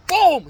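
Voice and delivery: loud high boom; loud boom sound effect. Retold or imitated. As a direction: smack the sound out. Retelling a slap.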